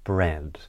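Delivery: "bread" falls low to close the list; downward inflection. Shown as a demonstration. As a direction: drop the pitch on "bread", finished. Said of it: "we would use a downward inflection".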